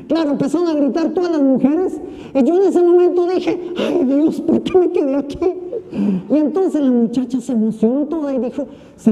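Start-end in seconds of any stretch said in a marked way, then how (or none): 3.8-5.4 exasperated sigh. Retold or imitated. Tired priest dreading the commotion.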